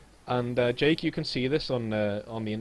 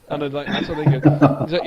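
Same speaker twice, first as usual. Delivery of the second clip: ordinary talk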